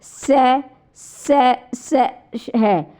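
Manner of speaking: स drawn out into a long hiss of friction, very slow; teacher sounding स for students to copy; hissing s demo. Shown as a demonstration. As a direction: hiss the स so students hear the air rub. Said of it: "आप उच्चारण करके देखो".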